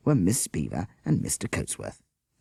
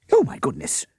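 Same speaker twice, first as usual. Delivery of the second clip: higher, louder shocked gasp; 10 semitones higher, 5 dB louder than usual. Acted. Miss Beaver seeing Bert's face, gasping. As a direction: catch your breath at the sight. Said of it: "she gasped".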